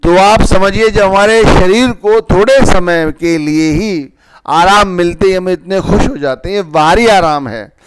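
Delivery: loud, high preaching voice; wise mentor. Acted. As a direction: preach it out, building with fervour. Preacher raises voice to exhort.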